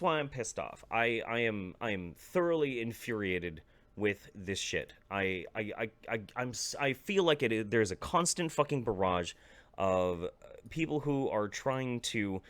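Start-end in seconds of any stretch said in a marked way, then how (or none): none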